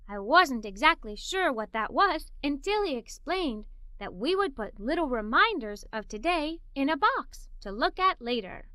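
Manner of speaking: plain reading voice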